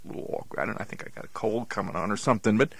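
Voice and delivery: normal talking voice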